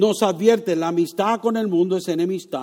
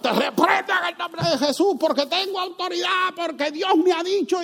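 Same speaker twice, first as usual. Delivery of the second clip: much higher, mock-bossy rebuke of the devil; mocking bold rebuker; 10 semitones higher than usual. Preacher mocks believers rebuking the devil, hammed-up bossy voice. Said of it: "Y empezamos".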